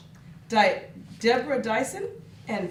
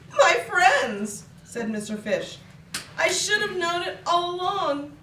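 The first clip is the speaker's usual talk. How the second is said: joyful realization; 6 semitones higher, 4 dB louder than usual. Acted. Fish's happy revelation.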